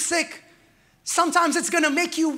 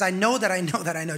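quick confident declaration; 6 semitones lower, faster than usual. Retold or imitated. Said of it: "He says".

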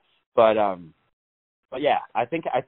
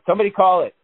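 higher, louder call for help; hammed-up plea to anyone nearby; mock upset; 8 semitones higher, 5 dB louder, faster than usual. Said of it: "you get upset".

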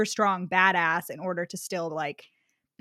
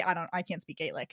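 normal talking voice

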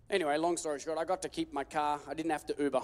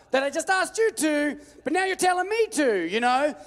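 high, loud, frustrated protest; exasperated complaint to God; 10 semitones higher, 5 dB louder than usual. Retold or imitated. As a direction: whine at God like he owes you.

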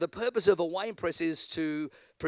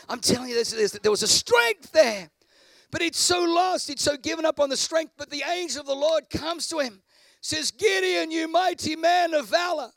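preaching at full force; 9 semitones higher, 5 dB louder than usual. Acted. Preacher shouting to the congregation, full force.

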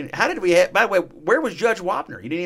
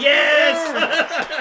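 high, celebratory yell; big exuberant yes; triumphant shout; 10 semitones higher, 3 dB louder than usual. Pretend. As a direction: shout it like a game show win. Celebrating a point on the soundboard.

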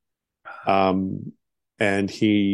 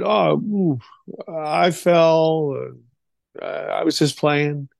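put-on higher-pitched, hesitant boy; halting mock-innocent excuse; sheepish child's excuse; 5 semitones higher, much slower than usual. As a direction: imitate a kid backtracking awkwardly. Child covering up under father's look.

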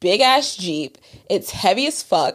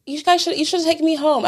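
higher mock whine; mock whiny friend; 7 semitones higher than usual. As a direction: whine it like the friend left behind. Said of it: "their friends that are with us was like".

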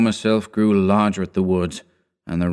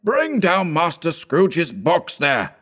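slow, higher, booming schoolmaster's call; terrible voice; 9 semitones higher, slower than usual. A narrator's reading. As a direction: bellow down the hall like a fearsome master. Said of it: "A terrible voice in the hall cried".

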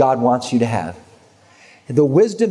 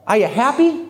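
higher-pitched, gently probing question; simple question; 9 semitones higher than usual. Retold or imitated. Man asking his friend about her boyfriend, simple question. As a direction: ask it simply, rising in pitch.

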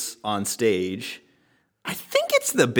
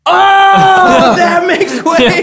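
laughing realisation; 11 dB louder, much slower than usual. Retold or imitated. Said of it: "I was like".

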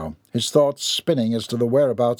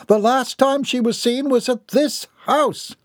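very high, anguished mother's voice; distraught; 13 semitones higher than usual. A narrator's reading. Mother reminding the group her daughter is missing, distraught.

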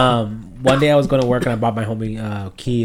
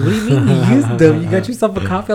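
higher, louder mock disbelief; retold incredulity; 7 semitones higher, 6 dB louder, faster than usual. Girlfriend questioning him about his coffee order, incredulous. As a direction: quote her pressing for answers, pitched up.

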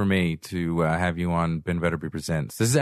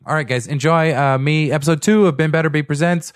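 podcast host intro; 8 semitones higher, 9 dB louder than usual. Acted. Host opening his podcast show.

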